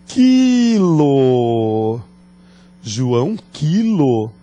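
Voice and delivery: quilo drawn out, stress on the qui; careful demo. Shown as a demonstration. Teacher slowly showing students the new word form. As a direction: lean on the first syllable of quilo. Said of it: "Isto mesmo".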